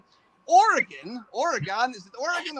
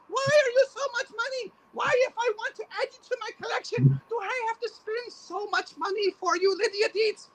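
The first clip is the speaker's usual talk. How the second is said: mock wail at a toy; 12 semitones higher than usual.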